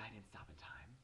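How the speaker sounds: hushed sheepish admission; whisper. Real, not acted. Teen admitting she ran the sign, whispered. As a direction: whisper it like a guilty secret. Sheepish confession after the slip.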